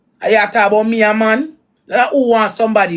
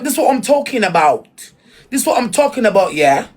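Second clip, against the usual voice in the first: mock English accent. Mocking someone who came back from England, mock English accent. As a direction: put on an English accent, punctuating with "yeah?". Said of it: "you make accent fool you".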